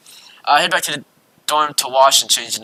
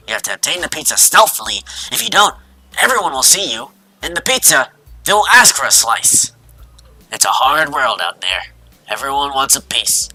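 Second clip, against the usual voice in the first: grave pizza avenger, swelling to loud outbursts; melodramatic Kenji; 2 semitones higher, 7 dB louder than usual. Acted. Kenji explains why pizza must be eaten in secret, melodramatic. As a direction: deliver it as an epic revenge monologue, completely without irony.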